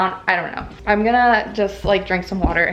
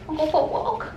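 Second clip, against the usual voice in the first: talking to a dog; 7 semitones higher, faster than usual. Real, not acted. Asking dog again.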